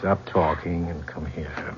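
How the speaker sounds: soft seductive command. Acted. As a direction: draw her in with a slow, soft order. Seducing secretary in closed office.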